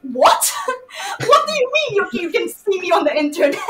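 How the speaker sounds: high, laughing disbelief; baffled teacher. Retold or imitated. Kim amazed her students saw her, high and laughing. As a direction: gasp it out in amused shock. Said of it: "and she's like".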